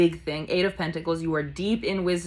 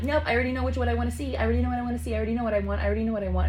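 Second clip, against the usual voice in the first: higher-pitched stubborn refusal, repeated insistently; imitating a brat who won't listen; mock stubborn; 5 semitones higher, much faster than usual. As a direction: dig in, pitched up and repetitive. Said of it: "like, stubborn".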